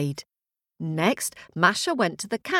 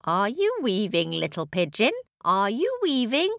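singsong question, swooping up high at the end; witch checking up; 4 semitones higher than usual. Acted. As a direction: call to the weaver in a lilting voice. Witch calls out again.

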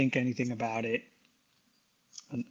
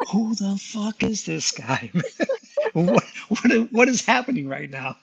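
laughing disbelief; 8 semitones higher than usual. Retold or imitated. Baffled narrator quoting own thought.

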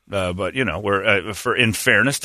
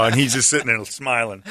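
ordinary talk, nothing marked

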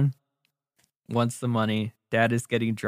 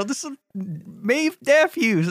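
put-on high, slow drunk announcement; drawn-out tipsy boast into the mic; hammed-up drunk dad; 13 semitones higher, slower than usual. Drunk dad introduces himself on stage, high and slow.

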